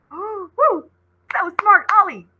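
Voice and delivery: ordinary delivery, nothing marked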